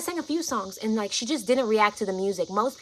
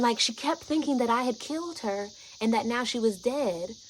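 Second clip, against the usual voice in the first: ordinary delivery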